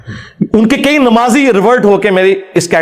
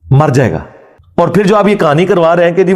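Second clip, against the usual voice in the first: ordinary talk, nothing marked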